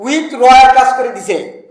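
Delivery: ordinary delivery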